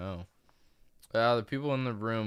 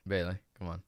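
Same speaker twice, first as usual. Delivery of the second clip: usual speaking voice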